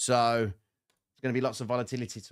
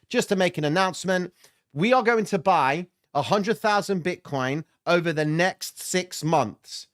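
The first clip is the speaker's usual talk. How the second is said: loud, higher mock official announcement; summit announcer; 6 semitones higher, 6 dB louder than usual. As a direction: proclaim it like a big public announcement, stretching the final words. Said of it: "Crypto Summit goes tomorrow and says".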